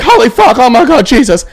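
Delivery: hammed-up panicking mother; mom's voice. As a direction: squeal it like a frantic mom on the phone. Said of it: "you know how mom's voice is?".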